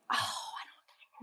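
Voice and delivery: slow, soft voiceless sigh of words; retold disappointment, under the breath; whispered dismay. Audience member about to miss a favorite song, whispering in dismay.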